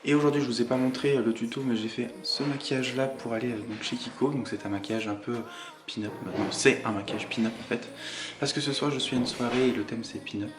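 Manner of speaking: hushed voice, kept low; talking quietly. Real, not acted. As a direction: speak softly so it won't echo. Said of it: "je parle tout bas, mais on est en bas et ça résonne".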